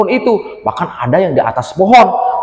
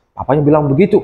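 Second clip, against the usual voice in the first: ordinary delivery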